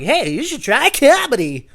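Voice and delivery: high, loud, drawn-out urging; excited mock suggestion. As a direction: call it out slowly, voice leaping up. Calls out advice to the stranger.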